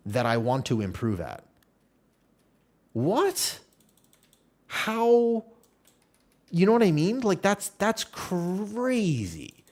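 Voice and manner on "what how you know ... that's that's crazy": much higher, stunned what and how; can't believe what you heard; high pitched in-disbelief outburst; incredulous disbelief. Real, not acted. Incredulous at commenters' cruelty.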